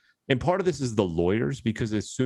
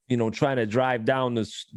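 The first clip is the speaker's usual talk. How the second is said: ordinary delivery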